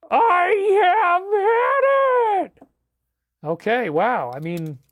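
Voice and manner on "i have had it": high, loud, long-drawn howl; fed-up wail. Acted. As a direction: stretch every word into a huge exasperated cry. Acted fed-up outburst.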